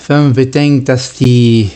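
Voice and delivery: ordinary talk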